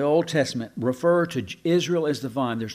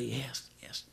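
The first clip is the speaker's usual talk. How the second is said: whispered yes; 3 semitones lower, 16 dB quieter than usual. Pretend. Congregation's imagined answer.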